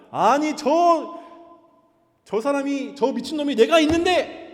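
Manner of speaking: loud, high, slowed-down outburst; outraged king. Acted. The king finds Haman on the queen's couch, outraged shout. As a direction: explode at the sight, spitting each word. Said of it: "왕이 화가 나서".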